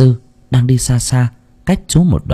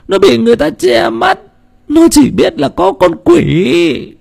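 put-on high-pitched elderly woman telling her tale; grieving old woman; 13 semitones higher than usual. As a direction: voice a frightened grandmother recounting the news.

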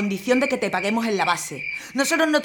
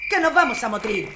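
raised call to comrades; rallying call; 6 semitones higher than usual. Acted. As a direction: rouse the troops onto the truck. Militia leader calls everyone aboard.

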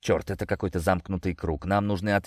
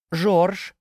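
high, loud startled cry of the name; sudden cry; 9 semitones higher, 4 dB louder than usual. Acted. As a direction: yelp the name out in alarm. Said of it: "вскрикнула кошка". (резкий вскрик)

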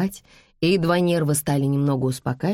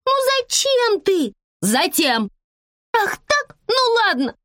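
put-on very high squeaky child voice; high-pitched bratty child arguing; indignant little girl; 3 dB louder than usual. A narrator's reading. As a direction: whine back like an outraged kid. Girl quarrels with her mother.

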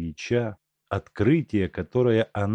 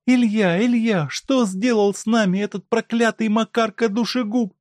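high, loud wailing question; anguished cry; 6 dB louder than usual. A narrator's reading. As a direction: cry out over the sick husband. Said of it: "вскрикнула Коркина".

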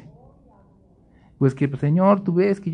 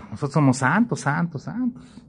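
ironic piety; 6 dB quieter than usual. Retold or imitated. Preacher mocking the church thinking itself holy, soft irony. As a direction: say it with sly irony. Ironic self-righteous congregation.

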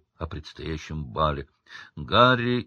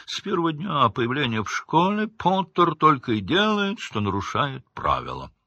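high-pitched mock teacher's complaint, falling at the end; Severus Snape; 6 semitones higher than usual. Harry quoting what his teacher would tell the interviewer, in a mocking impression. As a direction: imitate a disapproving teacher, starting high. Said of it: "интервью у Северуса Снега".

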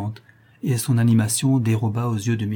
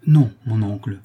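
lively retort; 5 dB louder than usual. A narrator's reading. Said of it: "répondit-elle vivement".

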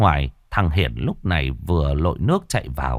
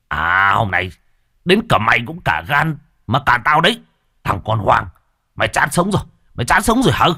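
menacing threat climbing higher and louder; rising rage; 4 semitones higher, 4 dB louder than usual. Acted. Father turns on the son who intervened, rising rage. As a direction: build from seething to shouting. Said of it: "lại càng lên cơn tức giận".

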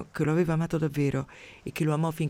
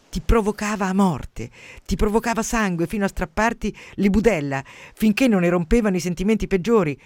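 heated; 5 semitones higher, 5 dB louder than usual. Real, not acted. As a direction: hammer home how he provoked you. Recalling a provocative friend. (con foga crescente, più alto e più forte)

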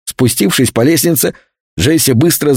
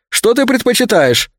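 calling out; 10 semitones higher than usual. Acted. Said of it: "крикнул Джек из кухни".

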